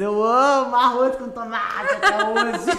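laughing through the words; slow, laughing admission. Real, not acted. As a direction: giggle out the confession. Laughing confession of loving rice.

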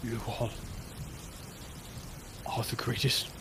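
quiet, solemn tribute ending near a whisper; serious. Acted. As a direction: say it softly and gravely, with long pauses. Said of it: "seriously and says".

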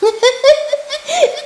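high, loud scornful laughter; spirit's mocking laugh. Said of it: "Ariel gülüp geçti".

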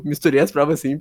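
ordinary talk, nothing marked

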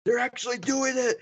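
high, shouted fan excitement; freaking out. Retold or imitated. Fan reliving the cameo, high excited shout. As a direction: yell it like a fan losing it. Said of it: "I completely freaked out".

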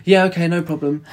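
high, loud, over-keen yes; squeaky burst of keenness; eager yelp. Retold or imitated. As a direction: jump at the chance, way too eager. Eager boy jumps at request.

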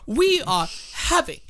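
slow, prim, overly polite gentleman; mock posh gentleman. Co-host voicing a fancy frog, slow and prim. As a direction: draw it out like a stuffy aristocrat.

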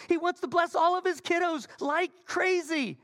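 exuberant proclamation. Real, not acted. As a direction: proclaim it to the whole congregation. Emphatic preaching climax.

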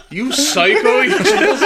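high, loud, laughing accusation; retold incredulous outburst, cracking up; laughing disbelief. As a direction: blurt it out through laughter, mock appalled. Retelling his shocked reaction to friend.

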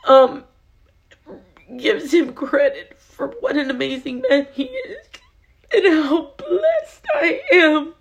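high, tearful voice breaking into a sob; crying. Real, not acted. Speaker on how blessed she is, crying.

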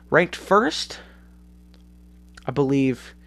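ordinary talk, nothing marked

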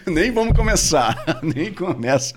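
real laughter, high-pitched words; laughing protest. Real, not acted. Friend refusing to start hours of jokes, laughing. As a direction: laugh while trying to shut the joke down.

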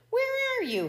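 bewildered coyote calling out; demanding. A narrator's reading. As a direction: demand it in a high, agitated voice. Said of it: "he demanded".

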